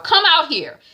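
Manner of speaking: high, loud call; stern summons. Retold or imitated. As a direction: call the daughter out. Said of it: "Mommy is like says to Shaliwa".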